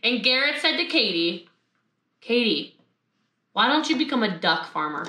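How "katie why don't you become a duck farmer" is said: low, level acted reply after hysterics; deadpan suggestion, pitched down; calm husband. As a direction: play the unruffled husband, low and measured. Calm husband answers wailing farmer.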